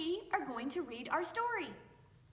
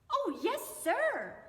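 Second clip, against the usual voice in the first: put-on higher and louder, eager child; little boy; 9 semitones higher, 5 dB louder than usual. Little boy eagerly agreeing to mind the sack, childlike. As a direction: answer brightly like a little boy.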